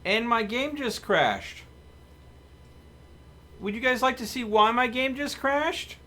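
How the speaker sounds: disbelieving it just crashed; loud, high exasperation at the crash; exasperated. Real, not acted. Game crashes mid-stream.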